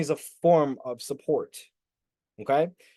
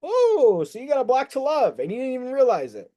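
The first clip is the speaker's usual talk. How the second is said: excited realization; 7 semitones higher, 3 dB louder than usual. Real, not acted.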